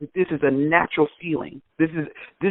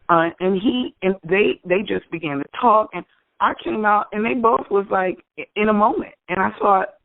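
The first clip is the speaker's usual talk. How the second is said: ordinary delivery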